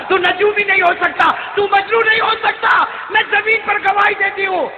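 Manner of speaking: high, shouted testimony; fervent testimony. Acted. Old woman testifying to her son, fervent shouting. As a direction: declare it at full preaching force. Preaches at full force.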